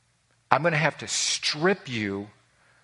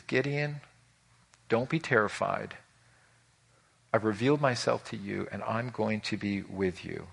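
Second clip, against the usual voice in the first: much softer, lower, gentle reassurance; calm a frightened man; hushed comforting voice of God; reassuring God; 5 semitones lower, 6 dB quieter than usual. Acted. God reassures terrified Gideon.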